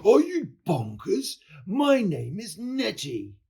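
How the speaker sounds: high, loud indignant cry; howling monster. A narrator's reading. Monster Nettie corrects George's mistake, high howling protest. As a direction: wail it high in outrage. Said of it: "looked down with a terrible howl".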